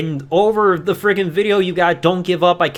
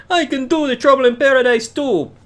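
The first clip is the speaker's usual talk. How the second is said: higher-pitched put-on Jamaican accent; 7 semitones higher, 4 dB louder than usual. Kofi one-upping his opponent with a move, Jamaican accent. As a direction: imitate a wrestler boasting in a Jamaican lilt. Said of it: "I just said the Jamaican accent".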